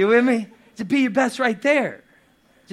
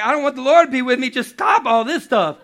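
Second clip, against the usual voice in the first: higher, louder, fast fed-up rant; exasperated, louder and quicker; exasperated outburst; 5 semitones higher, 5 dB louder than usual. Retold or imitated. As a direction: throw up your hands and quit on God. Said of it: "you'd be like".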